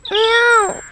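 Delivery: high plaintive meow; a child's high kitten mew. Acted. Kitten Pitschi meows.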